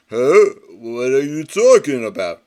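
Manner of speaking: a loud blunt huh; gruff puzzled brute. Acted. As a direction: play dumb like a big lug. Pig Iron feigns ignorance.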